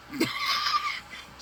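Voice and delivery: a sudden squeal of laughter, high and airy. Real, not acted. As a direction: let out a high-pitched giggle.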